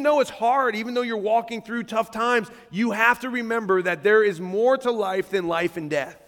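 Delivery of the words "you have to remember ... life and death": normal talking voice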